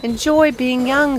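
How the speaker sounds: high, slow sunbeam voice; gentle sunbeam. Acted. Sunbeam advising the young tree, high and slow. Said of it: "said the sunbeam".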